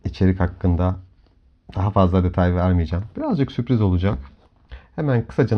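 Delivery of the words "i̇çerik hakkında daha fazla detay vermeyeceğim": ordinary talk, nothing marked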